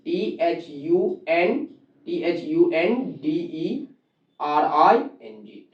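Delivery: slow, quiet letter-by-letter spelling. Shown as a demonstration. Teacher spelling thundering on the board, slowly. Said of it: "spelling thundering".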